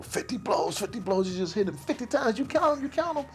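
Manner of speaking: very high, excited imitation; pitched-way-up, gleeful retelling; all excited. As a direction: mimic a thrilled fan crowing over a knockout. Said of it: "he's all excited".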